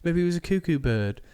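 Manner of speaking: put-on high, light English accent; a posh teacher's English lilt. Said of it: "And she's English".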